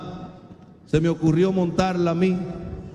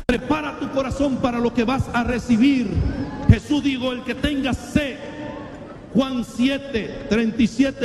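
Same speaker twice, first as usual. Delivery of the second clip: high, raised exhortation to the congregation; fiery preaching; 6 semitones higher than usual. Acted. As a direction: preach it out, calling them to climb aboard.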